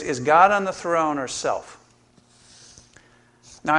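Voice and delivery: ordinary talk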